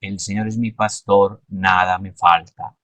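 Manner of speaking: measured reading, pitch stepping down on "nada me falta". Shown as a demonstration. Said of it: "Y al final termino ... Tan y bajo".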